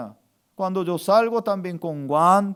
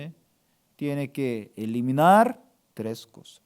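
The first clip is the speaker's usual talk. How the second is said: ordinary delivery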